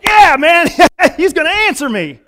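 high, loud, laughing mock swagger; mock cocky David. Preacher mocking David's cocky confidence, laughing and loud. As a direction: toss it off like a smug guy sure of his luck.

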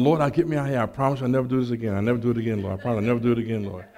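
low, rapid run-on bargaining; pleading prayer. Retold or imitated. Caught man promises God never again, pleading in a low rush. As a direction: imitate praying under your breath in a hurry.

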